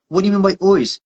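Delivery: high-pitched puzzled query; retold bafflement, pitched well up; baffled questioning. Interpreter questions the coach's word, baffled.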